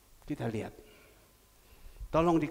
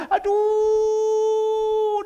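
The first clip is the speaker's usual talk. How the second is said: long high loud wail of aduh; imitated wailing cry; 13 dB louder than usual. Widow wails aduh on the phone, high and loud. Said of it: "dia teriak".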